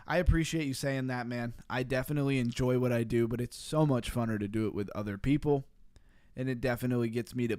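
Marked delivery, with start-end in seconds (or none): none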